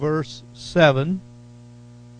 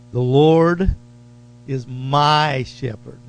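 "Lord" louder, rising, then a slow "is my shepherd"; stress on Lord; 5 dB louder, slower than usual. Shown as a demonstration. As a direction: lean hard on "Lord". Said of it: "Notice the emphasis".

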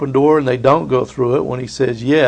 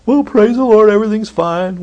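phony cheer; 8 semitones higher, 3 dB louder than usual. Retold or imitated. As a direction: fake cheerful praise, much higher and louder. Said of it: "We don't have to be phony".

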